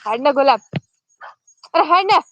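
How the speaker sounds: real scolding holler, rising in pitch; shouting at goats. Real, not acted. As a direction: shout to drive the animal along. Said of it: "म्हणून ओरडतोय".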